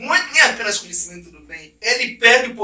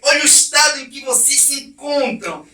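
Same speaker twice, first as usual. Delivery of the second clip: preaching God; 6 semitones higher, 4 dB louder than usual. Acted. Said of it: "O próprio pregador foi o próprio Deus".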